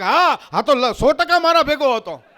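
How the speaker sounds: high, loud eager confirmation; hammed-up total agreement; emphatic false alibi. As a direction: swear to it loudly, overdone. Friend loudly confirms false alibi.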